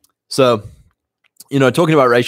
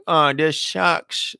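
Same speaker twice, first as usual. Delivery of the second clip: slow, higher, whining moan; mock self-pity, dragged out; whiny mock complaint; 6 semitones higher, much slower than usual. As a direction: drag it out like a sulky quitter. Mocking complainers in prison.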